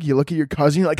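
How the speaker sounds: ordinary talk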